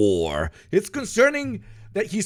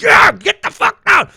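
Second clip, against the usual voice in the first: high, loud mock soldier yell; soldier's shout; 10 dB louder than usual. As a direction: bark it like a soldier storming a house. Soldier voiced in raid story.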